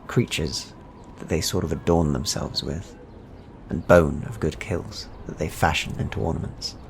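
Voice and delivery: normal talking voice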